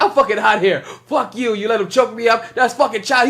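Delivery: high-pitched mock tantrum; cussing everybody out. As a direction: lightly imitate a boy ranting at everyone. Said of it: "he started cussing out everybody".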